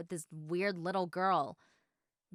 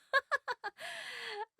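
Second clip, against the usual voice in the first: bright, high-pitched giggle; 14 semitones higher than usual. Acted. Talkative girl giggling at her own workout pitch. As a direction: burst into a little high giggle.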